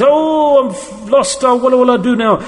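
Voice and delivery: high-pitched mock lament over a loss; hammed-up panic at a loss; mock wailing. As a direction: wail "oh" in put-on dismay. Acting out losing money.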